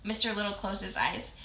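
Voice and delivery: ordinary delivery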